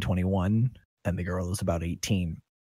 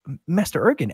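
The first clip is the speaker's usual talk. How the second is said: startled higher, louder greeting; awed surprise; 10 semitones higher, 8 dB louder than usual. Acted. Bar couple greeting their master as he arrives, awed surprise. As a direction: blurt it out, caught off guard.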